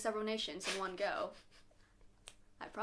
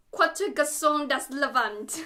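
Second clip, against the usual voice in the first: bad French attempt; 5 dB louder, slower than usual. Shown as a demonstration. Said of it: "That was not French".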